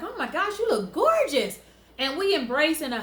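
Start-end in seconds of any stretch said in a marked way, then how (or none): 0.0-1.6 higher-pitched gushing compliment; gushing stranger. Retold or imitated. Girl stops her in a bar to compliment her, gushing. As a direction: gush like an admiring stranger. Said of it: "she stops me and she's like".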